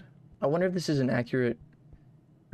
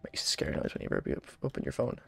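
scared hushed whisper; 4 semitones lower, 11 dB quieter than usual. Real, not acted. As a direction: breathe the words out, scared. Scared whisper in horror game.

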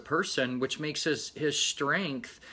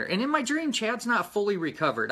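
ordinary talk

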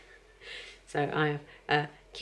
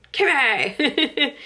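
loud, high mock yell of a name; sisters calling out; 9 semitones higher, 7 dB louder than usual. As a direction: call her like a sister across the house. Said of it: "My sisters used to shout".

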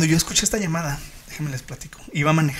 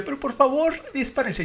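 desperate high plea; 9 semitones higher than usual. Retold or imitated. Caller begging police to kill the chimp, desperate. Retold frantic 911 call.

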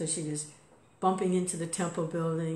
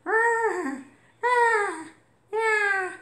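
high squeaky mock cat yowl, much louder; cat's cry imitated; 9 dB louder, much slower than usual. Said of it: "she keeps going".

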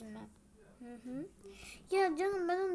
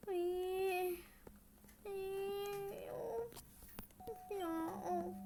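sobbing sigh; 4 semitones higher, much slower than usual. Acted.

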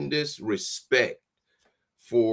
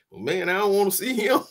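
quick, higher nervous protest; scared freshman; 8 semitones higher, faster than usual. Retold or imitated. Recalling fear at the coach's note, high and fast. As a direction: blurt it out like a spooked kid.

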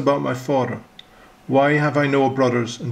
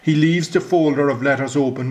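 usual speaking voice

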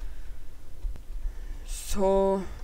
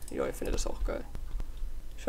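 whispering; 13 dB quieter than usual. Pretend. Answers himself for viewers.

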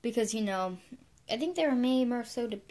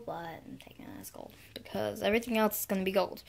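usual speaking voice

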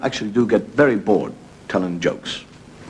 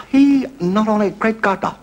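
higher, sing-song mock Japanese accent; 13 semitones higher, slower than usual. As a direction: play the Japanese dog breeder with a hammed-up accent. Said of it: "there's a Japanese fellow".

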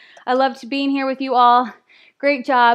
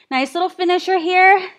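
ordinary talk

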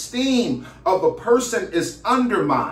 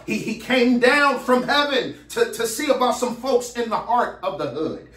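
preacher's rhythmic, higher-pitched refrain; fervent preaching; 4 semitones higher than usual. Real, not acted. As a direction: build a rolling sermon cadence, pitch climbing with each repetition. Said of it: "I feel like preaching now".

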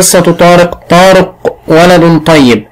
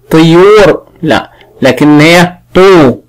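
طيور then تو said slowly, the طو sound drawn out; sounding out the vowel; slower than usual. Shown as a demonstration. Teacher correcting the vowel in طيور, slow and deliberate. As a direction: stretch the طو in طيور. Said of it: "طيور ما تنفع".

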